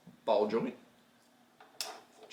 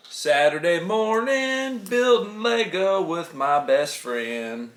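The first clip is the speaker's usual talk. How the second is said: 1 announcer intro; 6 semitones higher, 4 dB louder, slower than usual. Acted. Dad announces for camera.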